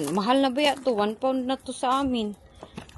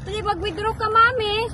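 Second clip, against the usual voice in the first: daughter's request; 7 semitones higher, 5 dB louder than usual. Retold or imitated. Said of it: "Sabi niya".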